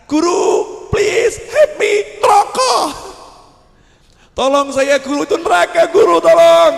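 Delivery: very high, loud pleading shriek from hell; a sinner dragged to hell shrieking for his teacher; desperate scream for help. Acted. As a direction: scream for rescue while being dragged.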